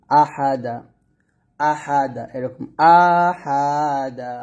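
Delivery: আহাদা dragged out very slowly, vowels held long; wrong stretched reading. Shown as a demonstration. Teacher mocking the stretched আহাদা error. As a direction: draw আহাদা out as the mistake to avoid. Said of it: "এরকম টেনে পড়বেন না".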